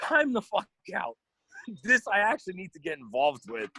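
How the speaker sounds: fired-up time-out call; excited outburst. Real, not acted. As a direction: call a halt, all worked up. Excited reaction to gossip.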